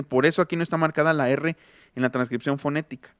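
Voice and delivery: ordinary delivery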